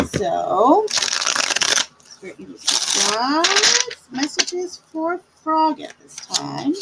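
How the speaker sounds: slow, hushed spirit invocation. Acted. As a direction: call on the spirits slowly. Calls on spirit guides.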